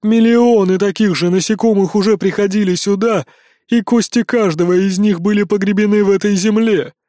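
loud, otherworldly giant woman; giantess voice. Acted. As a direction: loom over the insects and speak. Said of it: "проговорила громадная тётка".

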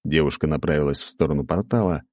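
plain reading voice

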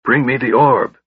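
a mild hint of an angry command, ORB leaned on; understated cold command; angry order. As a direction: order him, stressing orb. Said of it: "Raistlin ordered, staring angrily".

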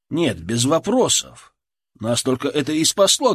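ordinary delivery, nothing marked

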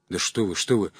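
ordinary delivery

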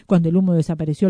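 ordinary delivery, nothing marked

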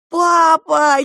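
high, loud call to father; children's cry. A narrator's reading. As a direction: cry out like children rushing to dad. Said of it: "кинулись к нему дети".

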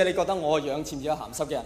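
usual speaking voice

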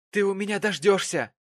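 heated father's warning; angry exclamation. A narrator's reading. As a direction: threaten your daughter furiously. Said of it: "восклицал мистер Пекснев".

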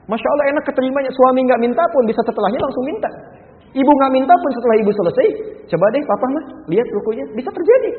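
loud, high, fast delight; preacher delighted at the happy result; excited exclamation. Real, not acted. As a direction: burst out with joy. Excited conclusion of story.

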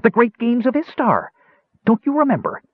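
very high, eager voice; thrilled, high little kender; excited kender. A narrator's reading. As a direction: remind him in high-pitched excitement. Kender excitedly recalls the games.

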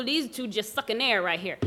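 ordinary talk

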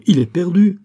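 loud, high cry of alarm; alarmed exclamation. A narrator's reading. Biot warns that Berthier is doomed, crying out. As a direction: cry it out as a dire warning. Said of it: "s'écria Biot".

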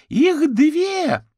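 very loud, high scream; panicked yell. A narrator's reading. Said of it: "Вопль из кухни прозвучал ещё громче". (панический вопль)

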